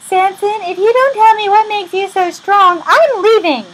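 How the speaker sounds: high, loud hammed-up threat to walk out; shrieky play-acted nagging; mock angry ultimatum. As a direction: scold your lover in a high, loud put-on voice. Delilah's ultimatum, acted quarrel.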